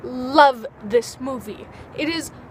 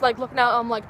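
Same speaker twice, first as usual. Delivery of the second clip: usual speaking voice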